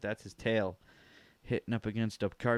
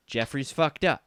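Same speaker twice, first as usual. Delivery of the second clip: ordinary delivery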